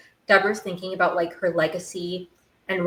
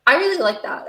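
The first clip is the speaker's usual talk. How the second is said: high, louder burst of delight; light up at the line, pitched up and louder; excited delight; 8 semitones higher, 5 dB louder than usual. Real, not acted. Repeating a line she loves.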